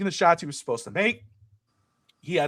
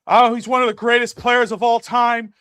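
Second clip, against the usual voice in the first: mock gushing media; 6 semitones higher, 5 dB louder than usual. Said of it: "They'd be like".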